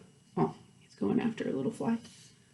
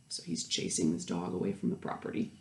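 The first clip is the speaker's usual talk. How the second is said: ordinary delivery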